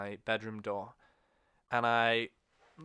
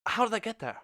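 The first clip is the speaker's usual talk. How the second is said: high, bewildered fake-innocent kid; mock-innocent surprised kid; feigning innocence; 6 semitones higher, 4 dB louder than usual. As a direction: play dumb about the mess, voice up high. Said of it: "and you're like".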